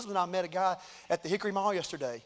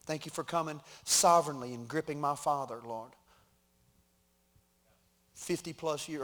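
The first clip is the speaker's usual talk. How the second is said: earnest prayer; 4 semitones lower than usual. Acted. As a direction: pray over the congregation gently. Pastor leads closing prayer.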